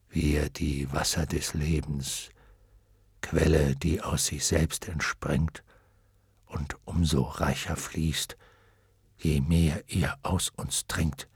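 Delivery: low, slow, soft repeating; slow, word by word. A narrator's reading. Dragon slowly repeating what the waters say. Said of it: "sprach langsam und Wort für Wort mit".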